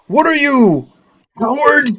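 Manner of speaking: high, loud rallying call; a hammed-up call to glory; heroic battle cry. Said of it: "and I'm like".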